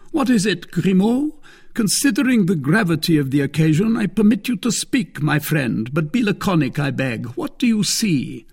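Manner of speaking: high, loud, formal command; master addressing servant. Acted. Athos permits his silent servant to speak, high and commanding. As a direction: call up to the lookout with lofty courtesy.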